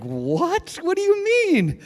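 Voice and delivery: high, slow disbelieving what; stunned question. Retold or imitated. Classmates reacting to the girl's news, high and stunned. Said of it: "we're like".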